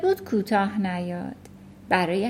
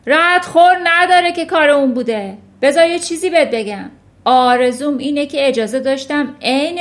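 loud, raised vindictive tirade; roaring; 5 semitones higher, 8 dB louder than usual. A narrator's reading. Headmistress wishing she could thrash the girl, roaring. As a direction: bellow with threatening relish. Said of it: "نعره زد".

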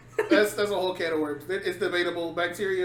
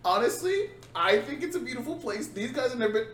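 higher-pitched mimicry of a friend; mocking friend impression; 5 semitones higher than usual. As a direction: parrot your friend back in a higher voice. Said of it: "That was a good impression".

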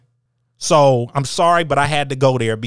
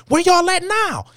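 calling out. Real, not acted. Challenging absent critics, high.

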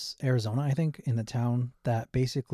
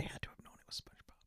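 whispering; 22 dB quieter, faster than usual. Real, not acted. Aside while co-host talks.

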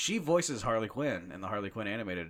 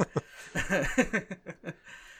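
embarrassed laugh; 9 semitones higher than usual. Real, not acted. Laugh after burping.

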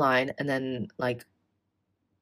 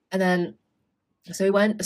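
ordinary talk, nothing marked

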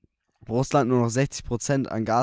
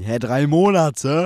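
surprised; 3 semitones higher, 7 dB louder, much slower than usual. Real, not acted. Counts months, shocked.

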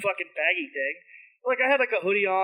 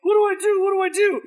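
high, loud frantic replay; acted panic; 12 semitones higher, 6 dB louder, faster than usual. Retelling his drunken freak-out to friends, mock panicked. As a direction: squeal it in a hammed-up freak-out. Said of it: "drunken freak out that I have".

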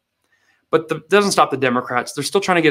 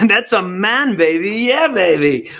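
lightly voiced groovy swagger; a hint of Austin Powers; mild Austin Powers; 3 semitones higher than usual. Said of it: "that voice".

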